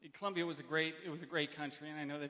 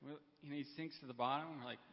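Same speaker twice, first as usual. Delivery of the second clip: normal talking voice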